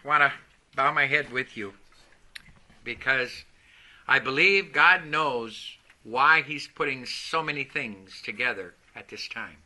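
quiet, low, prayerful voice; bow your head and pray softly. Acted. Opening prayer before the sermon.

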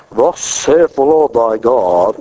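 pompous mock prophecy to a stranger; mock pulpit voice. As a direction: boom it like an old-time prophet. Mocking prophecy in grocery line.

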